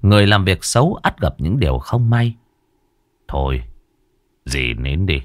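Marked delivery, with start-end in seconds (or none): 3.3-5.2 low, soft, tired hushing; weary comforting. Acted. Toàn wearily tells Loan to stop crying, low and soft. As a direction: gently hush her.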